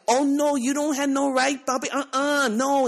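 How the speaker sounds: outraged passenger scolding the driver, mock diva; mock hysterical queen. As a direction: wag a finger, hammed-up diva refusal. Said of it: "hysterical queen, is like".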